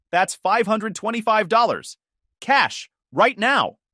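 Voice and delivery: high, loud ultimatum; hard demand. Acted. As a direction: demand the cash now. Joyce demands payment.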